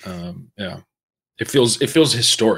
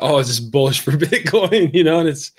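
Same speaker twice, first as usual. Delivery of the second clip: mock question breaking into a laugh, higher and louder; 6 semitones higher, 6 dB louder than usual. Real, not acted. Mocking their own crypto talk in wartime, laughing. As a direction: laugh through the quoted question, pitched up. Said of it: "I'm laughing".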